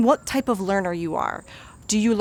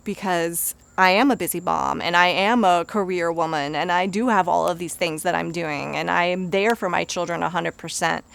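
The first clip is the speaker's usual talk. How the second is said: normal talking voice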